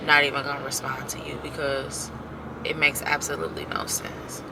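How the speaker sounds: unvoiced whisper; whispered exasperation. Real, not acted. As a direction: breathe it out as a bitter aside. Muttered aside about ignoring her.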